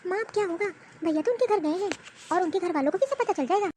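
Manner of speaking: a nervous little sister breaking the news; young sister. Acted. Sister tells brother his wife left with her family.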